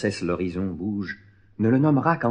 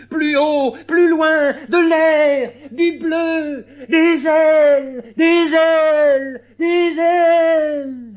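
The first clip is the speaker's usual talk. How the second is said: high, loud cries stretching out slowly; ecstatic cries; 9 dB louder, much slower than usual. Acted. Clown begging for air and wings, ecstatic drawn-out shouts. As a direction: call skyward, drawing out the last cries.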